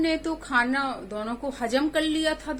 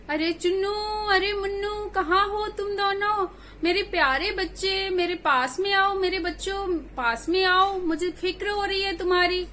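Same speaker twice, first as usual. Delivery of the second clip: put-on raised, higher-pitched calling for her kids; 7 semitones higher than usual. Mother goat calling her missing kids, raised worried call. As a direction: call out across the house, worried. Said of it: "वो आवाज दे".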